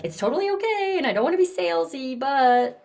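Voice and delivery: high and fast, nervous hedging; apologetic, hedging. Shown as a demonstration. Coach mimicking a timid apologetic sales pitch. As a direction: rush it out like you're sorry for asking. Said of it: "Instead of like".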